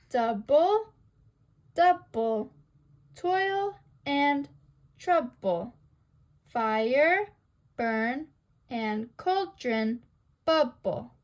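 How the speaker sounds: "double, double, toil and trouble, fire, burn, and cauldron, bubble" in separated DUM-da beats; trochaic scansion. Shown as a demonstration. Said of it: "This pattern of stressed, then unstressed".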